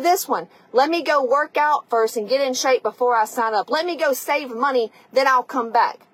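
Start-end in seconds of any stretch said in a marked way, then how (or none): none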